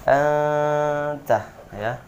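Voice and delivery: anta with the n held long and humming; correct nasal hold. Shown as a demonstration. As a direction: hum the n in anta for two beats. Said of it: "Dengungannya dua harokat". (dengung nun dua harokat dalam anta)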